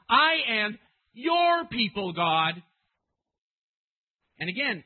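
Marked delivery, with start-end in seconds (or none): 0.1-2.5 "your" high, loud and drawn out; insistent reminder. Acted. Preacher as Moses reminding God, heavy stress on "your".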